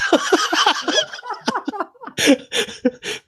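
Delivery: long high peals of laughter; real helpless laughing fit; laughing hard. Real, not acted. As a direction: keep laughing high until out of breath. Laughing at guest's apology.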